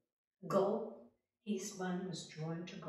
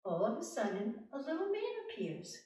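ordinary delivery

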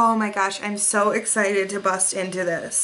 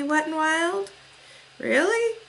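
mock indignant; 6 semitones higher than usual. Real, not acted. Complaining at the brand.

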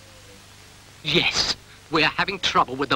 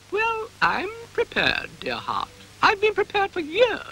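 high eager cow. Acted.

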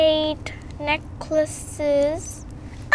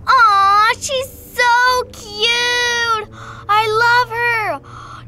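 cooing; 8 semitones higher, 3 dB louder, much slower than usual. Real, not acted. Cooing over a toy.

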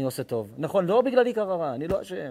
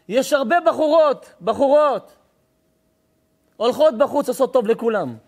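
preaching at full force; 8 semitones higher, 8 dB louder than usual. Acted. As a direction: thunder it from the pulpit. Rabbi preaching hard to crowd.

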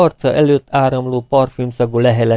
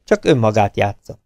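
ordinary delivery

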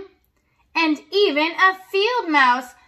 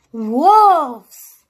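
louder, startled cry; 7 dB louder than usual. Acted. Scared dog cries out at the wolves, loud. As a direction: shout the alarm at the sight. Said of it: "he cried".

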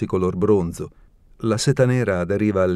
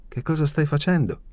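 higher puzzled question; ask, baffled and pitched up; 7 semitones higher than usual. A narrator's reading. Alina to Genia, puzzled question. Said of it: "chiesi io perplessa".

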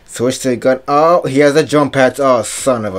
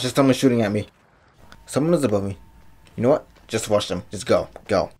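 ordinary talk, nothing marked

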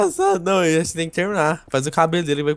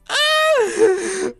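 shouted laugh. Real, not acted. Mocking the Herobrine drawing, shouted laugh. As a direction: let the laugh explode.

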